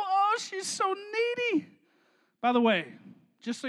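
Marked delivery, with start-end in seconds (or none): 0.0-1.7 high, drawn-out whine; teasing imitation of a whiny girlfriend; mock neediness. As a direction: mimic her clinginess in a stretched whimper. Mocks the clingy girlfriend.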